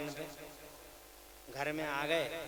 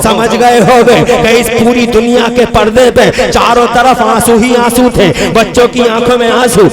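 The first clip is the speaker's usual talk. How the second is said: loud, high sermon at full force; fiery preaching; 6 semitones higher, 27 dB louder than usual. Acted. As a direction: preach to the crowd at the top of your voice. (पूरे जोश में तक़रीर)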